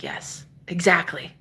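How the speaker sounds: slow, hushed whisper; conspiratorial whisper. Retold or imitated. Roommate confirming the portal, in a slow hushed whisper. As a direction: whisper it like a secret.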